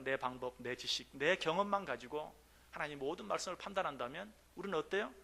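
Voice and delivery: ordinary talk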